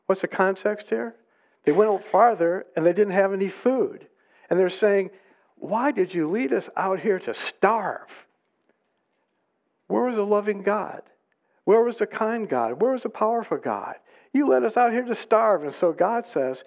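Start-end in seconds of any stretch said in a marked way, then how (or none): 5.6-15.6 high, accusing questions; grumbling complaint. Acted. Hungry Israelites accuse their leader in the desert, high grumbling. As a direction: pile up the protests, pitched high. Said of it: "I have heard the grumbling".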